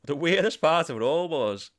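ordinary talk, nothing marked

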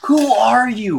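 high, loud disbelief; incredulous outburst. Real, not acted. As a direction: demand it, astonished and laughing. Incredulous in argument over gold.

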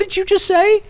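baffled cop. Retold or imitated. Retelling the arresting officer's reply to his offer, incredulous. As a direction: mimic the cop who can't believe his ears.

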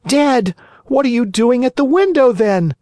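high, quick puppet voice; the wooden boy in disbelief; startled Pinocchio. A narrator's reading.

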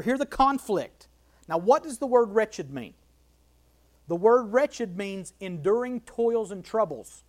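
normal talking voice

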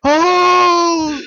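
high, slow, drawn-out rallying yell; heroic battle cry. Acted. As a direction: bellow it like a movie hero charging. Picturing a heroic movie scene.

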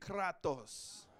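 Kratos said with a rolled Greek flourish; Greek accent. Shown as a demonstration. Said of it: "say it like you're Greek".

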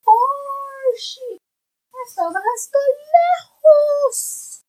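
high, loud far-off call; calling from far away. Acted. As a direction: call it out as if across a field. Reading the far sheep page.

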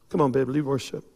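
ordinary talk

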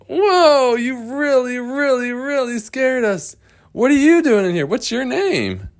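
high-pitched, startled voice; acted startled fright. Startled explorers question the girl in the cage, high and flustered. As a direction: stay way up high, recovering from a playful scare.

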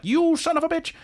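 very high, hammed-up indignation; mock outrage. Hodges hearing he will be replaced as boss, mock outrage. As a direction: squawk it in playful disbelief. Hodges reacting to Benedict's boast, acted.

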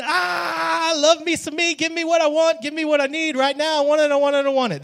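high, loud mock baby wail demanding everything; a newborn screaming at mama for what it wants. Crying baby yelling give me what I want. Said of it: "we're screaming".

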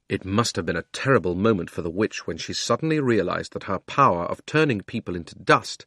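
plain reading voice

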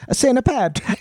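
high, hammed-up Italian accent. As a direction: say it like a cartoon Italian. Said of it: "like, an Italian accent".

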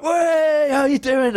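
high, drawn-out cheer; acted high group hello; mock cheery greeting.

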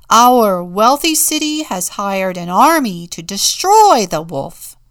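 big, loud public announcement; bold proclamation. A narrator's reading. Town leaders announcing a hired army, loudly proclaimed. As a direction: declare it grandly to the crowd.

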